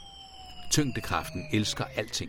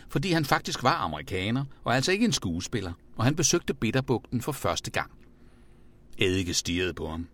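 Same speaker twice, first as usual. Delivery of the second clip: usual speaking voice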